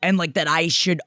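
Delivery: normal talking voice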